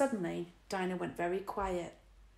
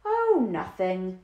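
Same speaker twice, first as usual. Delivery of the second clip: slow, breezy dismissal; airily; 7 dB louder, much slower than usual. A narrator's reading. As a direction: brush it off lightly. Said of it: "Lloyd said airily".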